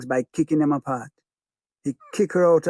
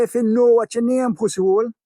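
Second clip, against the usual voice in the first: high, loud sneering taunt; mocking gangster; 8 semitones higher, 4 dB louder than usual. Gangster taunting the beaten father, loud sneer.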